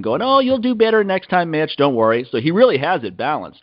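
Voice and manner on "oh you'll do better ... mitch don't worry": high, syrupy mock consolation; patronizing. Dad to son after a bad performance, patronizing. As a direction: talk down to him in a higher pitch. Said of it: "that guy who's all patronizing".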